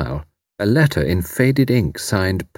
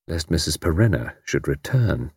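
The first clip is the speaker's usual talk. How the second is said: plain reading voice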